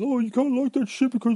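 high, silly mimicking voice; mock them in a squeaky put-on voice; mocking a naysayer. Ranting host imitates someone policing taste, high mockery. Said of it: "And if someone says".

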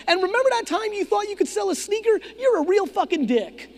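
high teasing roast voice; mocking friend. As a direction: needle him like an old buddy. Friend roasting him at eighty-three.

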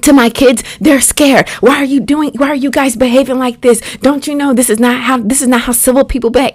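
high-pitched, rushed mock scolding; dad going off. Dad defending his scared kids, imitated going off. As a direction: imitate an indignant dad, words tumbling out. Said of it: "he's just going off on them".

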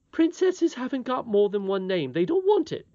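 high, loud nursemaid, dismissive; huffy nurse. Acted. Nurse correcting the princess, huffy. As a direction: answer as a prim nurse.

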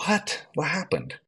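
high, soft incredulous question; retold "say what?" reaction, voice jumping high; baffled surprise. Retelling their baffled reaction.

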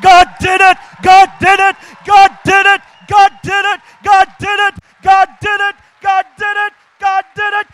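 loud, high climactic sermon shout; preacher building the church into a loud, high climax; full force preaching. Acted. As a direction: preach it to the rafters.

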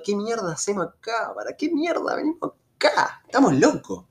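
high-pitched exasperated griping; retold cursing. Friend griping mid-trek about coming here, mock exasperated cursing. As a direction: replay your own cursing, pitch climbing high. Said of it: "yo ya estaba maldiciendo".

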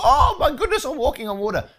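a squeal of disbelief at walking on water; astonished delight. Retold or imitated. Peter on the waves, squealing with laughing disbelief.

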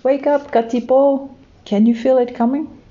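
high, loud, urgent calling voice; eager wake-up call. A narrator's reading. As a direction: rouse a sleeping friend, high and eager. Kiwi calling friends awake.

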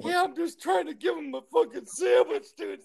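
very high, strained mock shout; retold exasperation. Coworker explaining the sandwich attempt, high-pitched exasperated yell.

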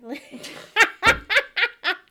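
big high burst of laughter; loud real laugh. Real, not acted. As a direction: cackle out loud at the memory. Bursts out laughing at own story.

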